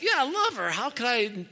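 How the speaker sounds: high-pitched, gushing objection; smitten protest. Retold or imitated. Young husband looking at his wife, smitten mock protest. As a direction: protest like a besotted newlywed, pitch soaring.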